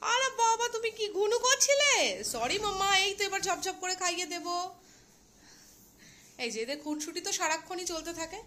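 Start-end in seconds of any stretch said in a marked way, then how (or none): 0.1-4.5 pet talk. Real, not acted. Owner greeting her pet who was sleeping, high baby talk.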